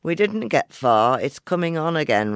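loud, high, hearty voice; loud call. Acted. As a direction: call it out over the wind. Man coming in from storm.

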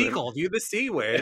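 a hammed-up, bad Australian accent; jokey mock Australian twang; awful Australian accent. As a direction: toss off a clumsy Australian impression. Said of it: "an awful Australian accent there".

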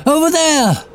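high excited call; shouting. Acted. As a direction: shout and point it out. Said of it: "she shouted".

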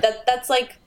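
ordinary talk, nothing marked

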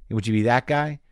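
ordinary talk, nothing marked

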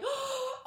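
a high, delighted gasp; swooning gasp. Acted. Picturing a girl smitten by a cheap date, gasping high.

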